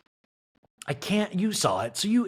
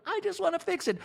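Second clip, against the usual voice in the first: mock sobbing; 3 dB quieter than usual. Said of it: "Kyle was sobbing".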